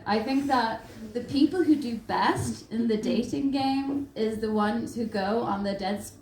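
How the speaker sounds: usual speaking voice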